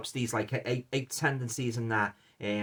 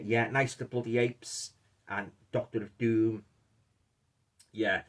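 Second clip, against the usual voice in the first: ordinary talk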